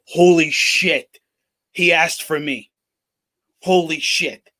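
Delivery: slow, drawn-out "holy shit", heavy with awe; awestruck reaction. Acted. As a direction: act stunned at being chosen, taking it slowly. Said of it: "they're not gonna be like".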